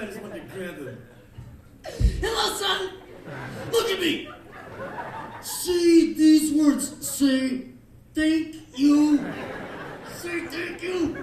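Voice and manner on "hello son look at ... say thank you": loud, high, slow angry demand; furious, deliberate ordering; mad lecturing. Acted. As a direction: drill each word into an ungrateful kid. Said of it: "It just made me so mad".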